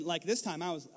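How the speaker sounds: usual speaking voice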